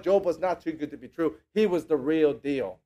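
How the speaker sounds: usual speaking voice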